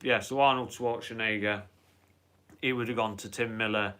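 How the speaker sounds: normal talking voice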